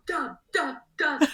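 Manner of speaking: high, chanted mock shout; imitated fan shout. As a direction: chant it like fans calling down. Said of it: "They even shout down".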